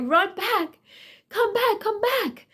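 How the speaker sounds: high urgent calling out, come back; DMV lady calling. Retold or imitated. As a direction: call her back up high in pitch. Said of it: "She's like".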